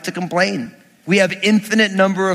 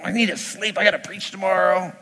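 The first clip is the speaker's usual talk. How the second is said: muttered inner grumble; 5 dB quieter than usual. Retold or imitated. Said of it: "I thought to myself".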